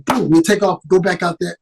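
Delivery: ordinary delivery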